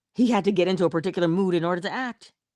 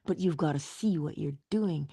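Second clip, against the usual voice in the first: ordinary talk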